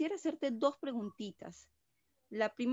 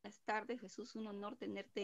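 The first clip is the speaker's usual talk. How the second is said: distant, thin speakerphone voice; talking away from the phone mic; far from mic; 7 dB quieter than usual. Real, not acted. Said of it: "de nuevo te fuiste, eh, se escucha lejos".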